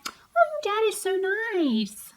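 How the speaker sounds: high, drawn-out mock sweetness; exaggerated sing-song fawning; mocking gushing. Mocks what everybody says.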